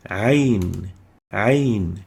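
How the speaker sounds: Ayn said from the throat; ع حلقية; Arabic letter ع. Shown as a demonstration. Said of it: "ayn looks like this".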